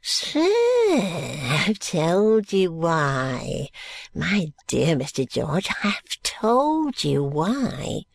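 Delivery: very slow, swooping plea; wheedling old schemer losing patience; exasperated old man. Acted. Old man pleads with the trooper.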